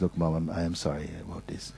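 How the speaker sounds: soft, sincere apology to a mother; genuine apology. Acted. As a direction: apologize quietly and simply, meaning it. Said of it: "she will know when you're genuine".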